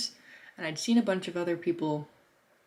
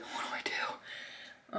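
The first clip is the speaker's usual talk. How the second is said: whispered, slow worried question; a slow, whispered fret to herself; anxious whisper; 9 dB quieter, much slower than usual. Retold or imitated. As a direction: whisper it to yourself, lost. Unsure at empty campsite.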